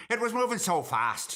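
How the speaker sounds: put-on quoting the pilot in an Irish accent; a lilting Irish pilot's report. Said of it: "I've done that in Irish accent".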